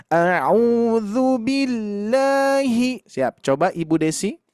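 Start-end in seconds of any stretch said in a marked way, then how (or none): 0.1-3.0 slow straight tune, no swing; level recitation. Shown as a demonstration. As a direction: keep "billahi" level and even. ("billahi" dibaca lurus tanpa ayunan)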